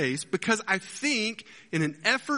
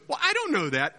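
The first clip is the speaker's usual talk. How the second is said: high-pitched flustered gatekeeper; mock panic; 11 semitones higher than usual. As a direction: squeal it, suddenly caught out. Gatekeeper fails his own question.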